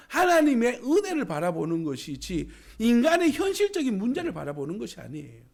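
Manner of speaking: usual speaking voice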